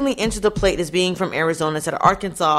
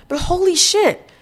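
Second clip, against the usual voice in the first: shocked outburst; 10 semitones higher, 3 dB louder than usual. Real, not acted. Reacting to the officers' mistake.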